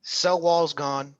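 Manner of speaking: cell wall's gone, drawn out slowly; hammering the point home; slow emphatic repeat. Shown as a demonstration. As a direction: spell it out for the class, slowly. Drilling the answer home.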